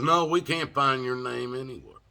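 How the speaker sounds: loud, high put-on jailer; retold jailer turning him away, loud and high; mock jailer. Jailer refusing him entry.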